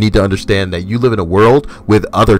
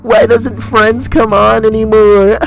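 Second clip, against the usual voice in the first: much higher, slowed sing-song mockery of a tipsy wine drinker; mocking drunk voice; slower than usual. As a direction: ham up a giggly drunk whine.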